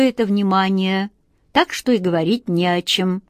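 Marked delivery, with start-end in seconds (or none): none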